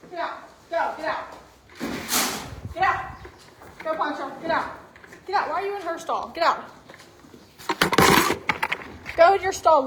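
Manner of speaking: normal talking voice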